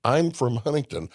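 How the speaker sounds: ordinary talk, nothing marked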